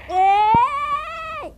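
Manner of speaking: loud high shout; calling out. Real, not acted. Gamer calling out to someone in the game. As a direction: yell to get attention.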